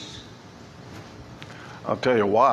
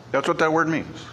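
ordinary talk

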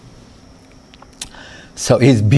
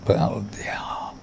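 whispered aside; 2 semitones lower, 13 dB quieter than usual. Acted. Aside after clever question.

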